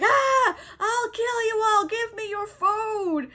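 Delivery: high-pitched hammed-up yell; imitating a would-be tough guy; mock tough-guy threat. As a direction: shout a silly, drawn-out menace. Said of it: "just trying to be a tough guy".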